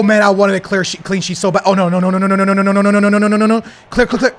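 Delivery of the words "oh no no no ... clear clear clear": panicked no. Real, not acted. Conceding danger, losing clean sheet.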